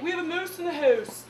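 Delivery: put-on thick Canadian accent. Roommate reports mice, in a thick Canadian accent. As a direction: put on broad Canadian 'ou' vowels. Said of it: "that's how she said it".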